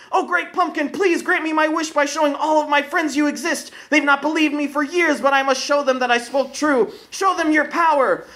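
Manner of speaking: put-on a child begging his idol; eager young boy. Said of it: "Linus leapt up and spoke".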